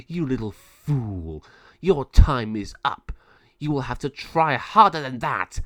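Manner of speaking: slow, scornful raven-villain voice; villain Malvado. Acted. Malvado mocks the captured girl with slow scorn. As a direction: sneer down at her.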